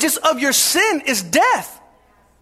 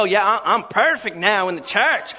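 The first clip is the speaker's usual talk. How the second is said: lower, lightly voiced smug boaster; a hint of a bragging churchgoer; 7 semitones lower than usual. Preacher mocking boastful churchgoers, lightly voiced brag. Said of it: "brag and go".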